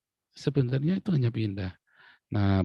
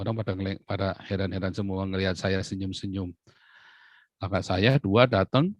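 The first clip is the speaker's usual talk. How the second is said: ordinary talk, nothing marked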